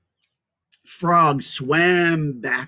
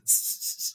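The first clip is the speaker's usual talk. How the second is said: hissing laugh; 11 dB quieter than usual. Acted. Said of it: "The snake laughed".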